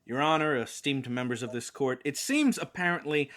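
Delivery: low, slow, dramatic closing argument; pompous attorney addressing the court; grave lawyer voice. Acted.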